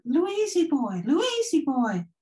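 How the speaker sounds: high slow sing-song call; mimicking a little girl's voice on tape; imitating a spirit voice. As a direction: echo the ghost child calling. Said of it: "and then she said".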